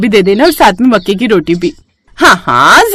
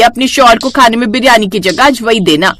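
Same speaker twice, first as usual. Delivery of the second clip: plain reading voice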